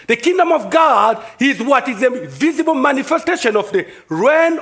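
high-pitched, emphatic sermon delivery; emphatic preaching. Real, not acted. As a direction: preach it with conviction, stretching key words. Preaches on kingdom of God.

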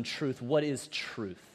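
slow, low, dismissive question; mocking. Pilate brushing off Jesus without wanting an answer, mocking. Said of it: "He says it in this mocking tone".

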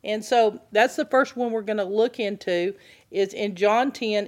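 usual speaking voice

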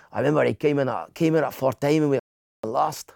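put-on Scottish manager's accent, retelling; put-on Scottish accent telling a story. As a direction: imitate a Scottish football manager. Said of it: "He's a Scottish guy".

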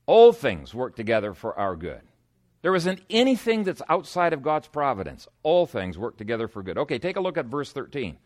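ordinary talk, nothing marked

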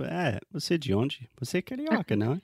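high-pitched mock curiosity in Portuguese; curious questioner. Teacher imitating people spotting a Rio accent, curious. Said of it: "as pessoas vão te falar, tipo".

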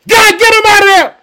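high, loud cry up to God; desperate shouted plea. Acted. Church crying out for Peter's rescue, desperate shout. Paraphrases church's urgent prayer.

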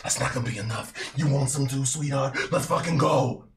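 rising from a taunt to a loud challenge; gleeful loud battle taunt; villain building to a roar. Acted. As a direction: build from a sneer into a shout. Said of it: "and he goes".

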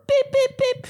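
three very high, short beeps; imitated alarm chirp. Imitating the mystery chirping at home, very high beeps. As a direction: squeak out three identical electronic beeps. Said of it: "something's just chirping".

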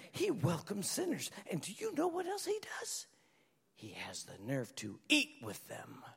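soft, scandalised whisper of gossip; muttering Pharisee. Retold or imitated. Pharisees grumble to each other about Jesus, soft scandalised whisper. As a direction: hiss it like a shocked gossip behind his back. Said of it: "they muttered".

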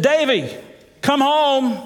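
high, sing-song call home; mother calling. Retold or imitated. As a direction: call a child in for supper, kindly. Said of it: "when Mother would yell for you".

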